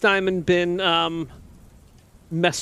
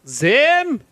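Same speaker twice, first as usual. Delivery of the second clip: raised, higher-pitched call; yelling out; 8 semitones higher, 6 dB louder than usual. Pretend. Player calls out for a missing companion, yelling. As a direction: call out a name loudly for someone missing. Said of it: "I'm gonna yell out".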